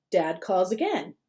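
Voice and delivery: usual speaking voice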